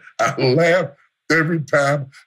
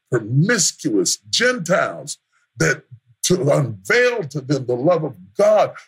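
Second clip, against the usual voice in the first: usual speaking voice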